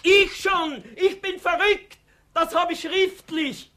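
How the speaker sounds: high, loud, slow triumphant cry; defiant shout. Acted. Herr Fuchs shouts back at the party leader, high and defiant. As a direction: fling it at him, high and loud.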